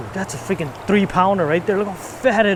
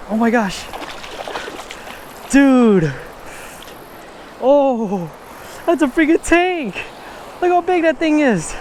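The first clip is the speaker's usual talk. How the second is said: excited shouting; 6 semitones higher than usual. Real, not acted. Still landing the big smallie.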